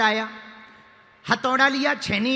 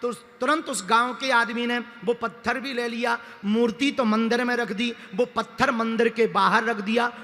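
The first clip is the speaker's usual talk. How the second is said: ordinary delivery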